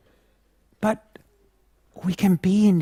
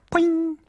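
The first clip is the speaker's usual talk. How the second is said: bulb popping sound; 11 semitones higher than usual. Retold or imitated. Light bulb blowing.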